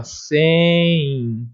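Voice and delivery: "Sem" stretched long into a nasal i, "sẽẽi"; long, open "Sem" without a closed M; drawn-out nasal glide. Shown as a demonstration. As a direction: hold the nasal ending of "Sem". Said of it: "parece que eu tô falando assim".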